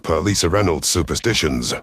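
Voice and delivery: ordinary delivery, nothing marked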